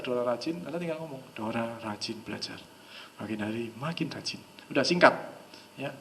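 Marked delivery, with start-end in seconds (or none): 1.4-4.4 low, hushed, even suggestion; soft hypnotic demo; heavy flat intonation. Shown as a demonstration. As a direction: murmur it without any emotion. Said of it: "intonasinya agak berat".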